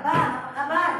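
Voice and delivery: loud greeting. Retold or imitated. Calling out greeting to him.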